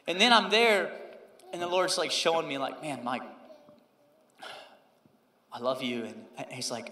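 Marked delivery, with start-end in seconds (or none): 2.8-6.0 tender Lord. Retold or imitated. Lord speaking tenderly to him.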